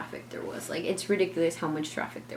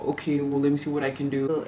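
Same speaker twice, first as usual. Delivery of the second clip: imitating husband; 4 semitones lower than usual. Narrator mimics Justin on the phone, lower.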